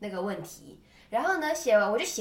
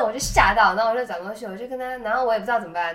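ordinary talk, nothing marked

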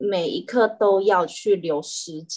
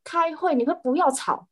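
higher, stern exasperated telling-off; annoyed scolding; 10 semitones higher than usual. Retold or imitated. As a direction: scold the bratty cat to hush. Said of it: "我就很生气". (生气地训斥猫咪)

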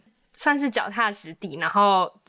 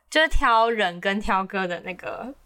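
ordinary talk, nothing marked

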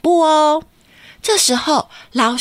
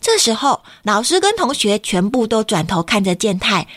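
plain reading voice

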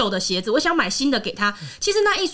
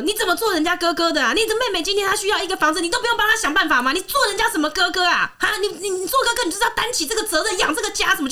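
mock scolding father; 7 semitones higher, faster than usual. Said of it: "他就骂他说什么".

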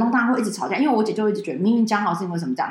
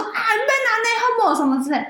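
worn-down elders; 13 semitones higher, slower than usual. Retold or imitated.

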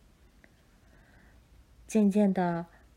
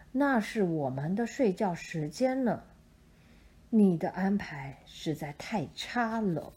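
low, grumbling owl voice; sleepy owl; 4 semitones lower than usual. Acted. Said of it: "猫头鹰说".

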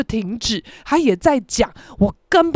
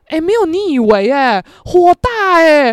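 louder, exasperated outburst, last words slowed; fed up; 5 semitones higher, 5 dB louder than usual. Real, not acted. Speaker complaining about mom, fed up and loud. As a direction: vent real annoyance, dragging out the end.

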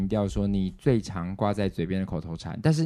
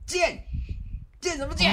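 much higher, mock scolding dad; imitating angry dad. Retelling how dad scolded her brother, mock angry father. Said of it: "被我爸大声斥责".

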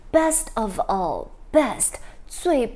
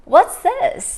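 surprised; 9 semitones higher, 9 dB louder than usual. Acted. Teacher surprised by the picture.

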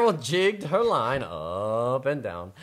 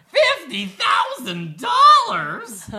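much higher, louder, slower shouted disbelief; father astonished at the money, shouting; amazed father; 8 dB louder, slower than usual. Acted. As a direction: shout it in stunned delight.

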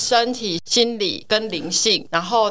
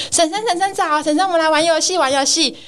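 put-on high, eager little-kid voice; aunt's nephew pestering her to play, high and eager; imitating nephew; 7 semitones higher than usual. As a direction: chirp it like a small boy begging to play. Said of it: "他就说什么".

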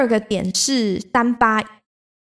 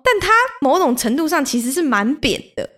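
ordinary delivery

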